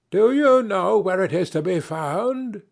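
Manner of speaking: a little mountain imp teasing a rider; ugly little dwarf. Acted. Dwarf on the rocks calls to the hurrying prince, high and sly.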